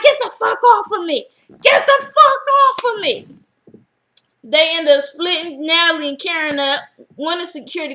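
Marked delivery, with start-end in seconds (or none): 0.0-1.3 higher, louder shout at the guard; mock yell. Drita yells at the security guard pulling her, a loud mock shout. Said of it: "She's like". 1.6-3.3 much higher, louder scream; bigger mock yell. Drita screams at the guard a second time, even higher and louder. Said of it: "She's like".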